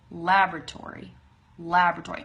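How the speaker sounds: "laboratory" as compact "LAB-ruh-tory"; "laboratory" stressed on the first syllable; front stress. Shown as a demonstration. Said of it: "emphasis is right there in the front".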